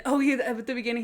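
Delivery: ordinary delivery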